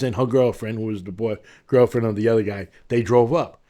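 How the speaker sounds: normal talking voice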